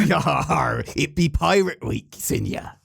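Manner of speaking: slow hammy pirate growl. Acted. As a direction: play a pirate captain. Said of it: "My, my pirate voice is pretty good".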